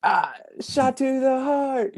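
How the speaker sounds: high, slow mock anguish; wounded cry. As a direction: groan it out like you were hit in the heart. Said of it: "And I was like".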